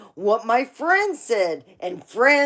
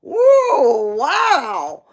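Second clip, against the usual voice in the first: put-on high, loud amazed gasp as a kid; 5 semitones higher, 9 dB louder than usual. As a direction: gape like a shocked twelve-year-old. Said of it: "I was twelve going".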